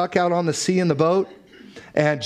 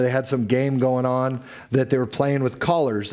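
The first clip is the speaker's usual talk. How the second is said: ordinary talk, nothing marked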